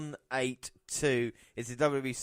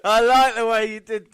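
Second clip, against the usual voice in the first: impressed; 8 semitones higher, 11 dB louder than usual. Real, not acted. Host reacts loudly to co-host's pick.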